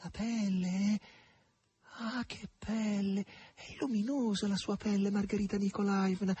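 high-pitched awed admiration; near reverence. A narrator's reading. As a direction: marvel at it, almost worshipping. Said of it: "disse quasi con venerazione".

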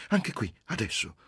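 high, eager daring; brazen, breath-quick invitation; bold. A narrator's reading. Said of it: "ella disse arditamente".

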